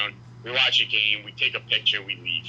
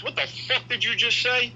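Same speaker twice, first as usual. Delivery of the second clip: high, confrontational angry demand; 11 semitones higher than usual. Retold or imitated. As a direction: snap back in disbelief. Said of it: "and he goes".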